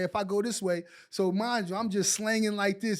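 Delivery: ordinary talk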